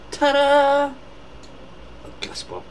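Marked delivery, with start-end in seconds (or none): 0.1-0.9 high, loud, drawn-out ta-da; ta-da fanfare. Pretend. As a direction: sing out a showy reveal. Presents a little birthday present.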